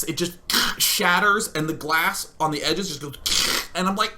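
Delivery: ordinary delivery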